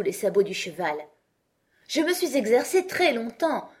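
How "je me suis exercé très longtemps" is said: high, insistent repetition; repeating stubbornly. A narrator's reading. Knight repeating to Alice while lifted up: insistent. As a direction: keep saying it, stubborn. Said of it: "répéta-t-il sans arrêt".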